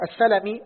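السلمي as as-salamī, short a's; fatha on sin. Shown as a demonstration. Student repeating for his teacher, السلمي as as-salamī. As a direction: open the س of السلمي with a. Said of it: "فهو بفتح السين".

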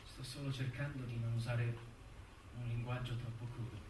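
low, soft, halting explanation; delicate caution. Acted. Lawyer avoids crude language with the defendant, soft and careful. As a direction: pick the words quietly and carefully.

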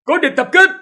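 high, loud alarm cry; enemy spotted shout. A narrator's reading. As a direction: yell the warning to the camp. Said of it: "bỗng hắn hét lên".

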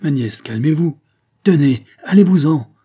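put-on exasperated girl rising to a furious cry. A narrator's reading. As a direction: drive them out, fed up. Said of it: "reprit la jeune fille exaspérée".

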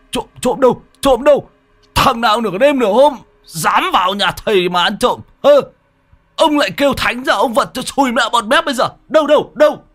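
high, loud blustering threats; acted high-pitched uproar; alarmed yelling. A narrator's reading. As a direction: jump up hollering, ready for a fight. Startled man shouts after thief alarm.

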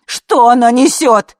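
high, very loud squeal; loud, drawn-out indignant cry; shrieked question. A narrator's reading. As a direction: shriek it, stretching the words. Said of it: "взвизгнула жена".

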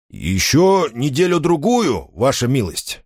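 slow, high earnest appeal; heartfelt. A narrator's reading. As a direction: plead with sincere feeling. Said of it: "проникновенно сказал он вслух".

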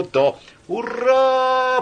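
high, long-drawn hurrah; triumphant shout. A narrator's reading. Another part of the crowd cheering, triumphant. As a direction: cheer it out in victory. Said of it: "победно закричали в другом месте".

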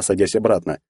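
ordinary delivery

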